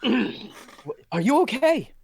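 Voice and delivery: worried. Acted. Professor to collapsed Lizzie, high worried question. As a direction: fret over her.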